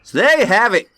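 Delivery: loud, high Australian announcer; big Australian sign-off; Australian accent. Shown as a demonstration. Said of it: "I'm nailing the accent".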